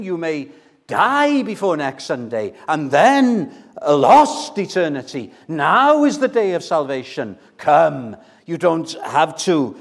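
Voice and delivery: forceful evangelistic pleading, swelling on key words; urgent preaching appeal. Real, not acted. Preacher asking who still hasn't come to Christ, urgently pleading. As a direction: press the listeners to respond now.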